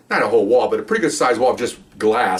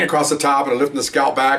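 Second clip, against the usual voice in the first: normal talking voice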